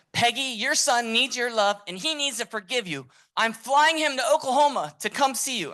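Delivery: very high, loud, impassioned plea; Richard Simmons. Retold or imitated. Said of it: "I kinda do a decent impression".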